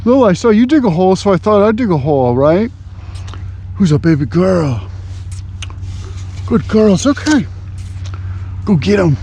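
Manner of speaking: high, fast, sing-song dog talk; pet talk. Real, not acted. Talking to the dog Lil.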